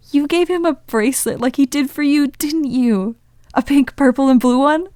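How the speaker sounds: bubbling over with excitement; excited. Acted. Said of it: "Charlie asked excitedly".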